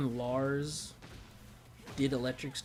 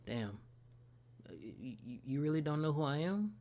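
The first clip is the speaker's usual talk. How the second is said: voicing a hurt character; 4 dB quieter than usual. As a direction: mutter it like she's heartbroken. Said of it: "she's like".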